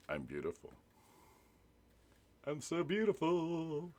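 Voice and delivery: slow, drawn-out mock self-admiration; mock vain preening. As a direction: savour the compliment, hamming it up. Mocking the bot's compliment.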